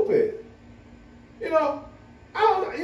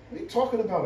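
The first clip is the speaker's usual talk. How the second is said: ordinary talk, nothing marked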